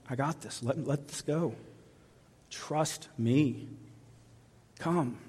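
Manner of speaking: soft, hushed comfort; quiet reassurance. Acted. God quietly reassuring a parent of a wayward child. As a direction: reassure them under your breath.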